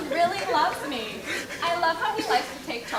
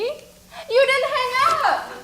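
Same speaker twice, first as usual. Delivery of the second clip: playful teasing; 10 semitones higher, 6 dB louder, much slower than usual. Acted. Teasing boyfriend on phone.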